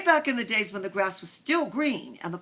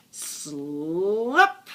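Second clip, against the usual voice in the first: long slurp sound, sliding up and swelling louder; drawn-out slurp; 5 dB louder than usual. A narrator's reading. Reader imitates the whisper phone dropping down, drawn-out rising slurp. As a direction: stretch the word into a rising slurp.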